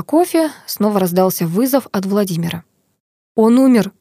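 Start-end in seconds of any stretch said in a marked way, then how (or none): 3.4-3.9 tense, raised news-breaking; a touch of agitation, a little louder; nervous. A narrator's reading. Professor breaks the news of a death, nervous. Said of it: "профессор здорово нервничает".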